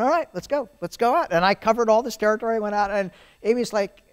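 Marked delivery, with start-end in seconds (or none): 0.0-1.3 high, loud, eager go-ahead; eager yes. Retold or imitated. Accepting the job offer to colleagues, eager and high. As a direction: jump at the offer, pitched up.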